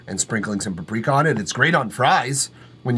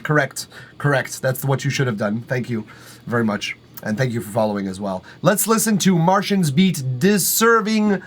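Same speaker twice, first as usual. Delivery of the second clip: ordinary delivery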